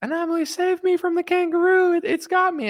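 very high mock falsetto plea; date in distress. Date calling to comedian from kangaroo's grip, mock-helpless falsetto. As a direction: squeal it like a hammed-up damsel. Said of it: "If a kangaroo took my date".

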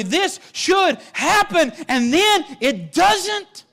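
loud, high preaching build that slows at the end; full-force preaching. Acted. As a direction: preach it out to the whole church.

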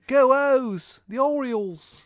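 very high, slow, loud bad Maryland accent; shitty Maryland accent. Ravens superfan greets the player in a shitty Maryland accent. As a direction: squeal it out in a hammed-up Maryland accent. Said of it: "shitty Maryland accent".

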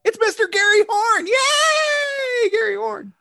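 very high, loud hyped-up cheer; mock fanfare cheer. Host introduces his co-host Gary Horn, hammed-up high cheering. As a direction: cheer like a whole crowd for the guest. Host hypes co-host's entrance.

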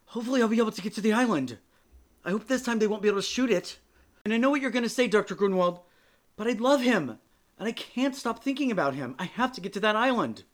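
loud, fast, frantic outburst; blurting out. Acted. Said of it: "Madeline blurts out".

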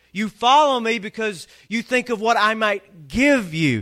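full-force preaching. Acted. Pastor voicing Jesus rebuking the crowd, fervent preaching. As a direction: preach it up to the back row.

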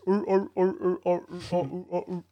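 high barking walrus grunts; walrus noises. Retold or imitated. Mocking a dad's voice as a walrus, high barks. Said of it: "That's how he sounds".

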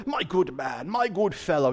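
a light touch of posh English, mock-polite; a hint of a posh English gent before a telling-off; lightly put-on English politeness. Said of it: "like English people that go".

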